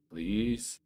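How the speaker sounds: normal talking voice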